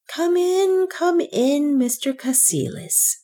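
high, fawning, wheedling welcome; a sickly banker fawns over his guest; wheedling old banker. A narrator's reading. Sickly banker greets his daughter's friend, wheedling and high. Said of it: "the wheedling, unreal tones in which he spoke".

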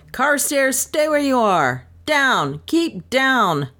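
urgent calling out. Acted. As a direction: call him back sharply, high and urgent. Calling to the dog in danger.

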